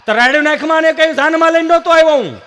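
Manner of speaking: loud, high yelled retort; mock yell. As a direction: yell back at the wife. Husband yelling back. (પત્નીને સામે બરાડતો પતિ, મોટે અવાજે)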